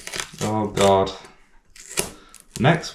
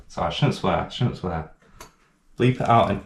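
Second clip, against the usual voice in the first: ordinary talk, nothing marked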